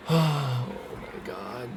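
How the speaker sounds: slow, drawn-out weary groan; despairing groan. Real, not acted. Gamer after blowing the lead, slow groan. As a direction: let it drag out in defeat.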